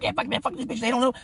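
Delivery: high-pitched worked-up griping; imitated angry grumbling. Kato still cursing as he's reasoned with, imitated griping. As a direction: keep griping high as the friend. Friend still ranting while being calmed.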